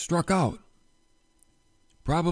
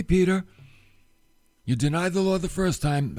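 normal talking voice

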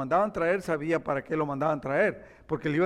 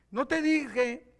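king's exclamation; 7 semitones higher, much slower than usual. Acted. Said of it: "exclamó el rey de Israel".

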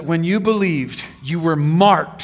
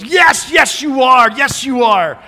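mocking devil; 5 semitones higher, 5 dB louder than usual. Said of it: "And the devil goes".

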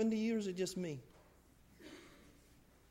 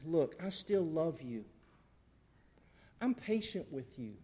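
tender reassurance; 3 semitones lower, 6 dB quieter than usual. Acted. God speaks gently.

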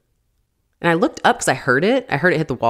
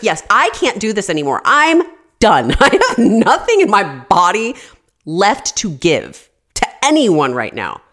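exasperated insistence; 5 semitones higher, 6 dB louder than usual. Retold or imitated. Exhausted wife insists.

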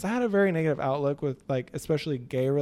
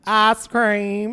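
twangy Texas ice cream, drawn out; a high little boy's thick Texas drawl on Ice cream; thick Texas twang; 6 semitones higher, 7 dB louder than usual. Retold or imitated. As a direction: kid with a heavy country twang. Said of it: "I had the worst twang".

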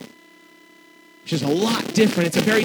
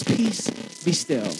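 softer and slow, a gentle calming order; calm, quiet command; 5 dB quieter, slower than usual. Retold or imitated. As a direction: hush the storm gently and slowly. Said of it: "He simply says this".

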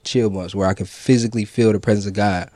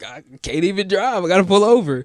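much higher, teary, overwhelmed voice; imitating crying; 9 semitones higher than usual. Woman retelling crying in the car, high tearful voice. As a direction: replay the sobbing in the car. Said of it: "like, bawling".

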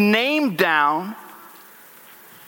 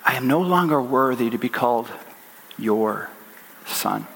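son rehearsing apology. Acted. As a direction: rehearse it haltingly, dropping away to almost nothing on "son". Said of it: "I can kind of picture him".

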